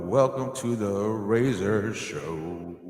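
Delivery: slow, drawn-out show opener; slow announcer intro. Acted. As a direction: stretch the welcome like an announcer.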